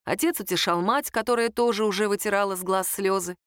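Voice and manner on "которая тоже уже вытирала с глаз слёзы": plain reading voice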